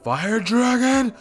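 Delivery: high, slow, loud attack call; battle-cry roar. Acted. Naruto showing his little roar thing, loud battle cry. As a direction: belt out the attack name and hit the last word hard. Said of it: "he would do that little roar thing".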